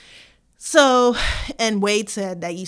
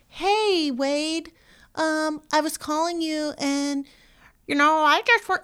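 imitating Michael's voicemail; 9 semitones higher than usual. Mimicking Michael's high voice on a voicemail to Wade. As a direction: lift the voice high and gentle like Michael. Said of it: "they played a voicemail where Michael was".

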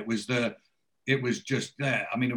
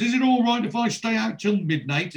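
high-pitched mock-childish pleading; taking the piss; 8 semitones higher than usual. [name] asks Brenda about staying out, taking the piss. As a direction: ask like a boy begging permission, mockingly. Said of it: "he takes the piss and he says to Brenda".

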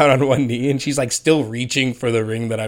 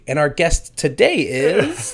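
mock host intro; 5 semitones higher, slower than usual. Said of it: "we'll just say".

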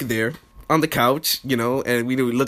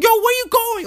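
very high-pitched mock female call; squeaky, demanding impression of a girlfriend; imitated girlfriend. Voices girlfriend calling him.

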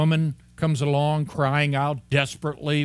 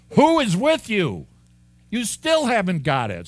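higher, louder, a teacher losing patience; exasperated Jesus; 8 semitones higher, 6 dB louder than usual. Retold or imitated. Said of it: "And he's going".

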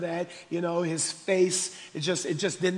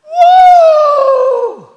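long, high, loud "woo"; ringing pulpit holler; preacher's whoop; 20 dB louder than usual. Real, not acted. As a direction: let out a sustained high whoop. Preacher whoops after his point.

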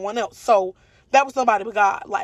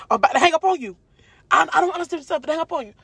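tough mom; 8 semitones higher, much faster than usual. Retold or imitated. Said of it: "she is tough".

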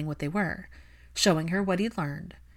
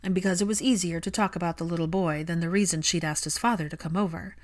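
plain reading voice

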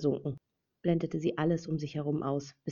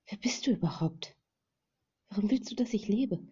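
hushed, breathless questions; whisper; 5 semitones higher than usual. Acted. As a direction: whisper it in exhaustion. Tired woman questions her rescuer. (geflüsterte Fragen)

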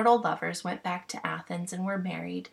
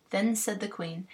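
ordinary delivery

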